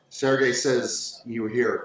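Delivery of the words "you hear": usual speaking voice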